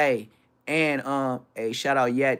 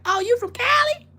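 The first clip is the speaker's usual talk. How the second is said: very high, louder mock voice; mock Cali voice; 7 dB louder than usual. Mimicking people in Cali asking where he's from. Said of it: "If you say that".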